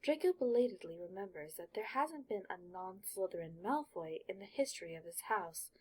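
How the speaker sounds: ordinary delivery, nothing marked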